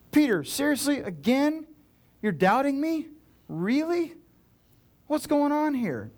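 high-pitched incredulous exasperation; high, frustrated string of questions; exasperated. Acted. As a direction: challenge a doubter, each question climbing in disbelief. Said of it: "I think he was frustrated with Peter".

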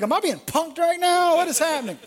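high-pitched bewildered protest; baffled disbelief. Acted. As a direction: play the man who suspects a prank. Baffled husband acted out.

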